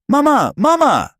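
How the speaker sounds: very high, loud cub cry; child calling out. A narrator's reading. Young cub calling to Mama, high excited shout.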